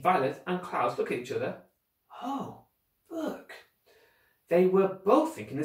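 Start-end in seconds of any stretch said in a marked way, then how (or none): 2.2-3.5 high, soft, airy echo; quiet dawning wonder; hushed realisation. Acted. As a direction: let the idea dawn softly. Children realise what baby meant.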